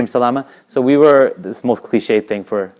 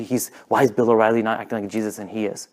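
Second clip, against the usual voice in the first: normal talking voice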